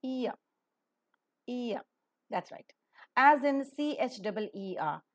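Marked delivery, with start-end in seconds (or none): 0.0-1.8 EAR as EE sliding into UH; gliding diphthong. Shown as a demonstration. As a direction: say EAR as ee-uh with a silent R. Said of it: "beginning with E and ending with A".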